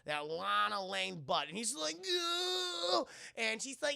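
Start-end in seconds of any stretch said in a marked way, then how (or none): 2.0-3.1 high drawn-out squeal; hammed-up squeal. Clark reacting to Lana bending over, high hammed-up squeal. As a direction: imitate a flustered, rising squeal.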